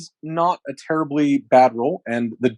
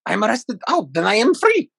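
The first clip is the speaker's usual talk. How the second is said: high, wondering prisoner voice; bewildered creature voice; 10 semitones higher, faster than usual. Acted. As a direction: ask it in disbelief.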